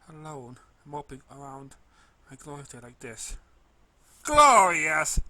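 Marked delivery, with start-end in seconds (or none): 4.2-5.2 loud, high, choking out the word through sobs; half-choke it between sobs, loud and high; choked sob. A narrator's reading. Crying filly throwing the word back at the pony, choked loud sob. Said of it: "she half choked between her sobs".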